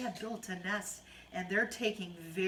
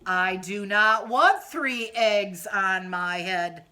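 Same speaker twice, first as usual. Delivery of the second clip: loud, slow refusal; firm protest; 9 dB louder, slower than usual. Acted. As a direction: stamp your foot, insist. Elephant protests.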